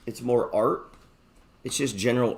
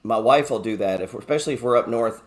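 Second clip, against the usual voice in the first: ordinary delivery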